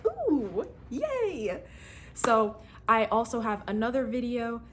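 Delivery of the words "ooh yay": higher, playful squeal of joy; a cute little celebration; mock cheer. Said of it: "here I am like".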